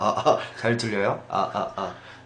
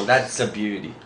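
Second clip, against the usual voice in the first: guy admiring a car; 7 semitones lower, 6 dB quieter, much slower than usual. Retold or imitated. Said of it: "이런 식으로 많이 남자들이 많이 쓰죠".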